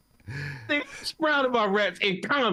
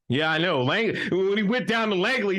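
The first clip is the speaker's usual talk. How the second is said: usual speaking voice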